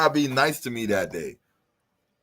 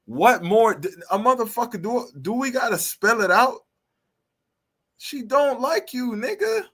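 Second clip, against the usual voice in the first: high-pitched exasperated disbelief; real exasperation, voice climbing much higher; 10 semitones higher than usual. Real, not acted. Exasperated rant at the guest.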